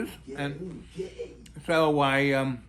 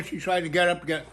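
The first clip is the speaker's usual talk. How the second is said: ordinary delivery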